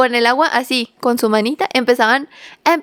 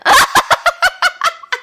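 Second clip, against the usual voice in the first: bursts of high laughter; real laughter. Real, not acted. Laughing at own story.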